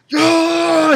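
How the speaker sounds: high, drawn-out mock scream; imitated scream. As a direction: act out an anguished scream without full volume. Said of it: "he's screaming".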